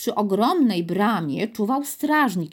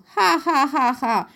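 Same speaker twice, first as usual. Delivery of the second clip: acted "ha, ha" guffaw, starting high; big storybook guard's laugh; mocking laughter; 5 semitones higher, slower than usual. A narrator's reading. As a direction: laugh scornfully in spelt-out ha-has. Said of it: "śmiał się strażnik".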